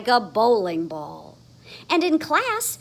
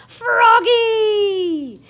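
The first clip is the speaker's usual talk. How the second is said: loud, high proud shout; reading louder; 12 semitones higher, 11 dB louder than usual. Acted. Froggy reading his name tag aloud, shouting. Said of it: "louder and louder".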